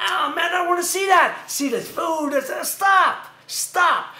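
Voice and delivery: worked-up comic rant; exasperated scolding. Acted. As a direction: order the sloppy eater to quit it. Scolding man eating open-mouthed.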